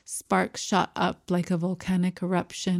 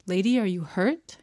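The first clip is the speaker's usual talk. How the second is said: ordinary delivery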